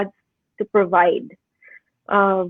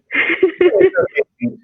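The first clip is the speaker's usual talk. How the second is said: teary laugh; 7 semitones higher than usual. Real, not acted. Embarrassed laugh after tears.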